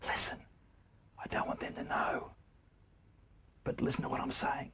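projected stage whisper, pushed with air; loud whisper. Shown as a demonstration. Teacher shows students how a whisper can carry across the room. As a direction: whisper hard so the far listener hears. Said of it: "It's a loud whisper".

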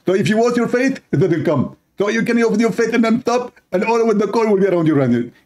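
high, fast put-on voice; acted spouse voice. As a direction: gabble the excuse in a high silly voice. Wife then husband explain eyeliner.

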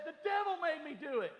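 high, sing-song excuse; Eve's excuse. Retold or imitated. Preacher voicing Eve excusing herself, mock innocent. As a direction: toss off the blame, mock innocent.

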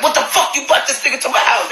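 a hint of a furious father yelling; angry dad. Dad yelling at his daughter for bringing him home, mock angry.

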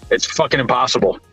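ordinary talk, nothing marked

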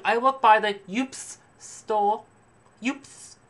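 high, drawn-out snob, "ups" said as one word; mock a haughty clerk mangling U P S into "ups"; snobby saleswoman. Haughty clerk boasting, saying "ups" as a single word. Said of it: "She's like".

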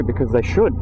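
usual speaking voice

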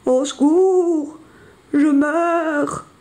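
high, long-drawn wail for help; mock wounded moaning. Jean-Claude fakes injury to lure the bison, wailing. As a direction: moan as if hurt, hammed-up. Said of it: "se met à gémir, comme s'il était blessé".

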